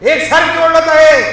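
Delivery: high, loud shout of complaint; exasperated complaint. Acted. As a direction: shout it as a fed-up disciple. Disciples complain about the woman.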